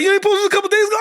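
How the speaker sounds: very high, incredulous imitation of wife; mock disbelief. Wife doubting husband just posted it, high mock disbelief. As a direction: question it as if you can't believe it.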